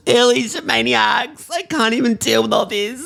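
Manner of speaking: high, emotional sign-off; overwhelmed. Acted. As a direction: gush at the listeners, overcome. Signing off, overwhelmed by news.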